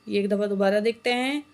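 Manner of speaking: ordinary talk, nothing marked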